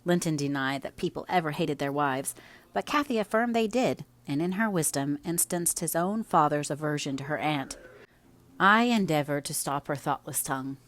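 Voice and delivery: plain reading voice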